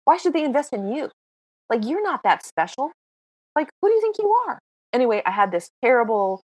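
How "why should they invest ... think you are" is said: scornful self-talk, rising high at the end; high, fast jeering "who do you think you are"; mocking inner critic. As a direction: sneer at yourself. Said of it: "I kept thinking".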